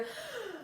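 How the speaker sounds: een grote hap lucht naar binnen; big gasping breath. Shown as a demonstration. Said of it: "it doesn't have to be a huge breath".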